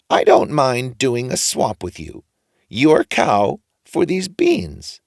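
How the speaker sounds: high, drawn-out agreement; odd old man approving the boy; funny old man, slow. A narrator's reading. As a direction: draw it out knowingly.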